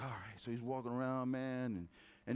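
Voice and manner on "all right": much lower and softer, giving in; meek eagle. Retold or imitated. Little eagle gives in to the chickens, quiet resigned mumble. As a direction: mumble it, defeated. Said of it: "that eagle's like".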